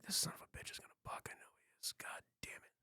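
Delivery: hushed grumbling whisper, fast; muttered whisper. Retold or imitated. Cowboy whispering curses while saddling a bronc. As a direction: whisper the curses under your breath.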